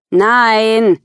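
put-on curt teenage snap; snapped no. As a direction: bite off the refusal. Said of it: "schnappte Tiffany".

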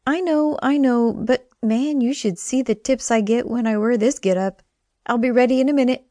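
a hint of a perky friend; Wynn's voice. Wynn's voice in story.

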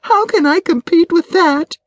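high, hurt, helpless voice; tearful despair. A narrator's reading. Wife despairs to her friend over the younger woman, tearful. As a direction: pitch it high, like a wife near tears.

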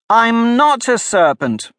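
slow, high, loud protest; indignant. A narrator's reading. Alice protests to the pigeon, indignant and loud. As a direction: insist hotly, offended. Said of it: "said Alice indignantly".

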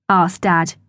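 ordinary delivery

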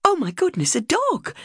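high, delighted gasp of surprise; wife amazed at the puppy gift, high surprised cry. Retold or imitated. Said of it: "I cried, totally amazed".

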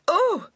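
sudden high yelp of pain; cry of pain. Acted. Injured woman trying to rise, high pained cry. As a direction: gasp as the pain hits. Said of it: "She fell back again, grimacing with pain".

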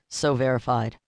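usual speaking voice

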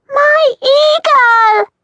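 put-on high, loud, drawn-out toddler shout. Toddler shouting for her brother, high slow and loud. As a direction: bellow it like a stubborn little child. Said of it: "Christina shouted again".

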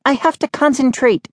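high, irritated complaint; snapped. A narrator's reading. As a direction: protest crossly in a high voice. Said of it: "she snapped".

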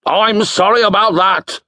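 put-on theatrical booming old wizard. A narrator's reading. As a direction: boom it out like a grand wizard. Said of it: "said the wizard in a booming voice".

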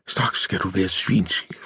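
softly spoken reproach; hushed, restrained complaint; subdued. A narrator's reading. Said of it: "siger hun dæmpet".

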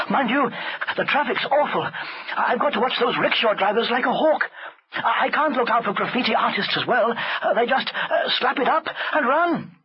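put-on high, hesitant boy making excuses; innocent servant boy. A narrator's reading. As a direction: explain it away to the master. Chulak makes excuses.

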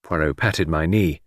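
plain reading voice

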